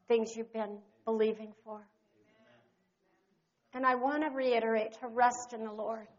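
low, very soft ministry voice; hushed closing prayer. Real, not acted. Pastor ministering softly at the close, hushed prayer. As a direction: settle them into rest.

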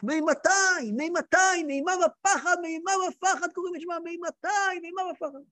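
high, repeated cry, fading at the end; ecstatic crying out. Acted. Reb Zusha falls into ecstasy over the Gemara, high-pitched crying. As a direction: chant it in rising holy dread. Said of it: "התחיל לצעוק".

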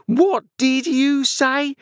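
very high, slow, outraged shriek; drawn-out scream. A narrator's reading. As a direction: stretch out each word as you start screaming. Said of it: "beginning to scream".

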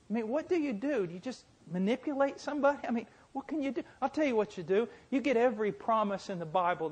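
high-pitched rhetorical questioning; urgent preaching. Real, not acted. Preacher asking his congregation how to help the man, urgent preaching. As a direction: throw the questions out high and insistent.

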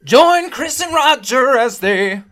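high, loud and drawn-out announcer call; show intro hype. Pretend. Host announcing Chris and Roger's show, hyped intro. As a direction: belt out the show's opening slowly and high.

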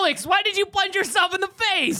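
high, loud mock disbelief; teasing shout. Real, not acted. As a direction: call him out with glee. Teasing after the prank.

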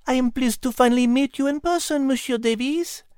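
high, French-tinged cat voice; polite cat meowing her greeting; cat pleased to meet the minister; talking cat Liz. A narrator's reading. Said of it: "Liz meowed".

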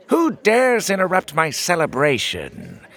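high, loud tyrant king; shrill outraged little king demanding answers; strange shrill voice. Acted. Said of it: "came a strange, shrill voice".

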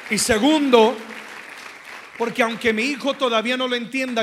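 preaching at full force. Acted. Pastor to the congregation, loud full-force preaching. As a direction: preach it out to the whole church.

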